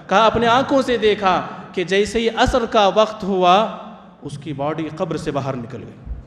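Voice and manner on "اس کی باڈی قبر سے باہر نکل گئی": dropped to a hush before the reveal. Real, not acted. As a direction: say it low and soft, building suspense. Repeated climax, hushed take. (دبی آواز میں)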